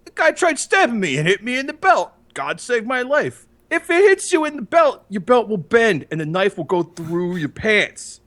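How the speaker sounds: high, mocking impression of Carson; lightly voiced Carson, high and incredulous; retelling Carson's story in a mocking high voice. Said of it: "Carson comes out and he's like".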